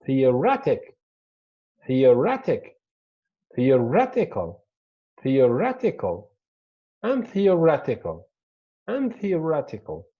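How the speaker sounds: "Theoretic, theoretical" loudly punched on RET, then falling; penultimate stress. Shown as a demonstration. Teacher demonstrating the shift before a strong suffix, RET stressed. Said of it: "on the penultimate".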